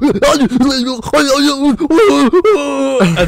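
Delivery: loud, high strangled gurgling; hammed-up gasping and choking noises; imitated choking. Imitating the rival choked with one arm, mock strangled gasps. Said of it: "And he's like".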